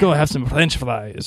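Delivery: hammed-up French accent; mock Frenchman with a thick French accent. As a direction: put on a jokey French accent. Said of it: "You like my French accent?".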